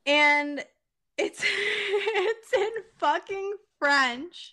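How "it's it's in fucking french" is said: high, slow, laughing through the words; barely getting it out for laughing; laughing disbelief. Real, not acted. Telling her cohost about the French hearts, laughing hard.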